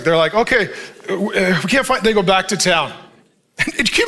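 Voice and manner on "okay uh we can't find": ordinary talk, nothing marked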